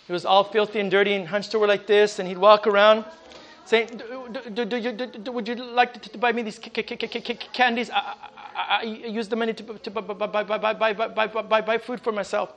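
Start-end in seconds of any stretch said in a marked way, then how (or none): 4.0-12.5 soft, halting stutter on every word; stuttering beggar. Retold or imitated. Preacher imitating the stuttering beggar softly. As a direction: mimic the man stammering as he begs. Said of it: "he would be like this".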